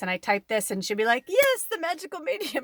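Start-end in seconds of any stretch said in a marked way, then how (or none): 1.3-2.6 high-pitched, gleeful mock announcement; imitated excited call-out. Host calling out a viewer on her live, excitedly imitated. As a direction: exclaim it high, as a retold shout-out. Retelling host's delighted shout-out to her.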